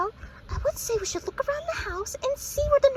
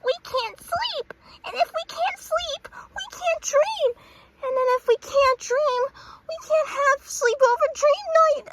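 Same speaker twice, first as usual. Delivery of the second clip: whiny lament; 5 semitones higher, 5 dB louder, slower than usual. Acted. Monica whining about lost sleep.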